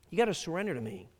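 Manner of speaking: ordinary delivery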